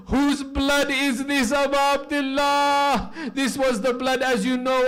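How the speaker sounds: high, loud grieving sermon cry at full force; impassioned lament. Acted. Preacher crying out Sakina's grief to the mourners, loud and high. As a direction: pour the grief out over the mourners.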